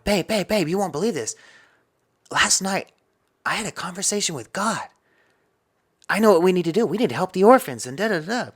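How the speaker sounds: high, eager reenactment of breaking news; retold excitement. Retells waking wife with big news.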